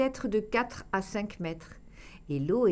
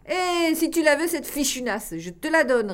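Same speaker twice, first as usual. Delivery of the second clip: higher, louder, put-out retort; vexed; 7 semitones higher, 6 dB louder than usual. A narrator's reading. Loul answering the teasing warden, vexed outburst. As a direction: snap back, stung and offended. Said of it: "répondit Loul vexé".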